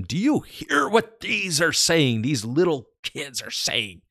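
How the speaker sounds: high, sneering, indignant demand; livid leaders' question. Acted. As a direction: challenge him like an affronted priest. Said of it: "these angry, livid religious leaders".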